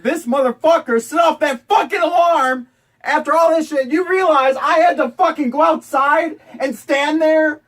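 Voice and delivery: shouting in real outrage; furious shout. Real, not acted. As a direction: blow up at the roommate. Roommate caused alarm, ranting.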